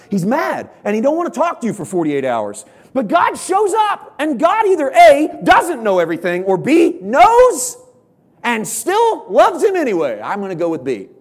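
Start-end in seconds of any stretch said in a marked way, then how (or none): 2.9-10.2 loud, high, slow preaching build; full force preaching. Acted. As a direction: drive it home from the pulpit.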